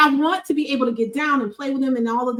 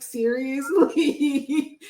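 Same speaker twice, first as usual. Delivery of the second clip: slow incredulous; much slower than usual. Retold or imitated. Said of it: "I'm like".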